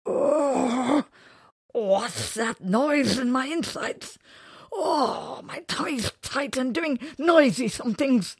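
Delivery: high, loud alarmed creature voice; surprised creature. Acted. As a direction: yelp it out, startled by the tummy noise. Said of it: "his eyes popped open in surprise".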